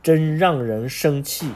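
ordinary delivery

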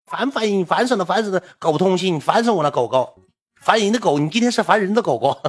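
high, loud, shrill-free nagging rant, repeated; mock angry scolding. Imitating the boyfriend yelling at her over video, high and loud. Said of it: "一顿给我骂".

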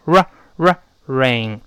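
"R，R，rain" drawn out slowly, tongue tip curled back toward the throat; slow "R，R，rain" with a strongly retroflex R. Shown as a demonstration. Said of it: "舌尖尽量的往回勾".

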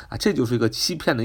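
ordinary delivery

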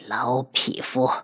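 soft, low growl of hatred; low, seething curse. A narrator's reading. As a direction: curse the enemy under your breath. Enraged fighter curses the villain.

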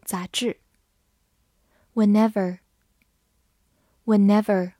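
usual speaking voice

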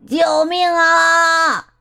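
high, very drawn-out shout; long high distress call; cry for help. A narrator's reading. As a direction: holler for rescue, stretching each syllable. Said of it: "那声音叫着说".

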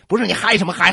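usual speaking voice

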